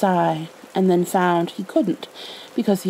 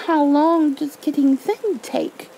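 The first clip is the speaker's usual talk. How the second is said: slow and a bit higher, worried; anxious; 8 semitones higher, slower than usual. A narrator's reading. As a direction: ask it with mild worry. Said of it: "asked Pooh anxiously".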